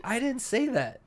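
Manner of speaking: high-pitched mock denial; retold protest. Retold confused denial to Jake.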